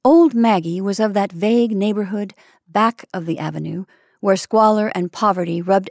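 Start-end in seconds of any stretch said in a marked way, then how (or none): none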